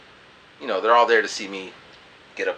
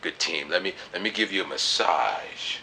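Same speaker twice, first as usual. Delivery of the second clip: much lower and softer, slowing at the end; low smooth reply; 7 semitones lower, 10 dB quieter than usual. Retold or imitated. Offering the girl a massage, low and soft. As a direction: lay on a low, smooth charm. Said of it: "and like".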